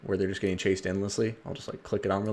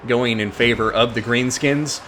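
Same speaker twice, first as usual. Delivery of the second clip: energetic esports caster voice; live play-by-play; 2 semitones higher, 8 dB louder than usual. Acted. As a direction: call the battle like a live caster.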